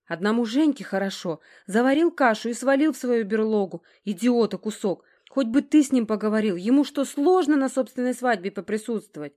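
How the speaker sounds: indignant rant. A narrator's reading. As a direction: rail about him, building to a cut-off word. Distraught girl rants about her brother.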